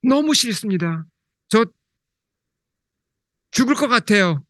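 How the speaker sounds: a hint of a sobbing son pleading; mock wailing plea. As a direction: beg as if wailing, pitch lifted high. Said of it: "이게 울부짖은 겁니다".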